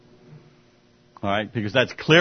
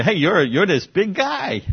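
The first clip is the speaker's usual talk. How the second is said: slow, higher mock praise; drawn-out sarcastic compliment; 7 semitones higher, slower than usual. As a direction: lay the flattery on thick, slowly. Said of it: "the sarcasm here".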